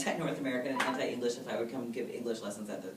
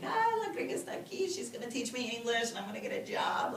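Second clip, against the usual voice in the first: put-on high, excited kid calling up from the street; a lightly voiced girl calling out, pitched high; girl yelling; 10 semitones higher than usual. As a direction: shout it up like a thrilled little girl. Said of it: "this girl yelled up at me".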